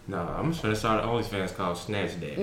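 low, softened put-on voice; mock smooth-talker murmur; Snatch Daddy voice. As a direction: keep it deep and quiet, playfully. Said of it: "It's my Snatch Daddy voice".

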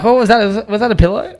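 high, loud mock-baffled question; mock puzzlement. Teasing his mate about weak punches, mock puzzlement. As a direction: act baffled by a feeble punch. Mocking a punched person's reaction.

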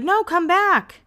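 mock high plea; voicing Audrina. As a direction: lightly play a pleading friend. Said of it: "Audrina's like".

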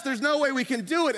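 usual speaking voice

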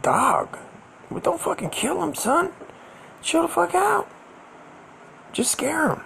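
very high, squeaky mock urging; high-pitched retold plea. Talking down friend with a knife, high-pitched plea.

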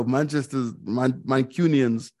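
ordinary delivery

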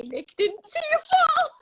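high, loud squeal of comfort; hammed-up husband's consolation, high and loud; mock reassurance. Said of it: "Tim's like, "Don't worry, honey".

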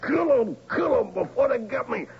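high panicked hollering of a friend's plea; retold holler. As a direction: cry it out high as the drunk friend did. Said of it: "he'd holler".

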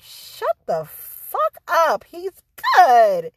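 hammed-up indignation, pitched high; mock mad. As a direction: retell it as exasperated, grudging praise. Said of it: "so mad about that".

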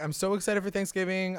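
imitating excitement. Hermosa gushing to the family, lightly mocked excited. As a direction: gush like the character, lightly.

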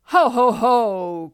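slow, booming villain's ho-ho; terrible cat voice. A narrator's reading. Cat mocks two land crabs, terrible loud voice. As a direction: bellow it out long and menacing. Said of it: "cried the cat in a terrible voice".

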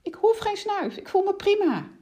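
rider's high, insistent outcry; crying out. A narrator's reading. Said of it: "riep de ruiter".

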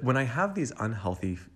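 normal talking voice

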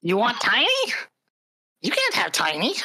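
high, possessive goblin refusal; goblin bandit. Acted. Goblin answers the player's demand.

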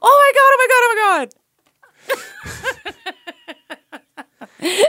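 squealing oh my God, high and loud; shrieking jackpot winner. Acted. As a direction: gasp and shriek with delight. Shocked gasp, squealing realization.

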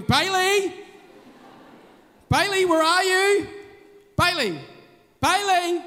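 loud worried calling. Retold or imitated. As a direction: call the boy's name through the house. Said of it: "we start saying that sort of stuff".

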